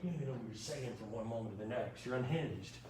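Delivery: soft, quick mock scolding from a student; imitating L G. Lecturer voicing a student mocking his slip, soft quick jab. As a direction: toss it off fast and hushed, like a teasing classmate's jab. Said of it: "L G is gonna be like".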